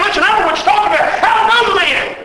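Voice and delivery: high, fast, frantic swearing denial; cursing denial. Acted. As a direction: spit out the denial in a panic. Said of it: "he begins to curse and swear".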